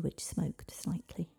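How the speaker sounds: ordinary delivery, nothing marked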